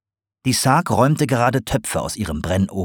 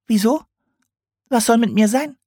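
much higher, innocent owl voice; owl on defensive. A narrator's reading. Owl denies.

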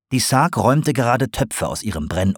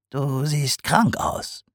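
very slow, softer potter voice; gentle diagnosis; 6 semitones higher, 4 dB quieter, much slower than usual. A narrator's reading. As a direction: say it slowly and gently. Sarg observes.

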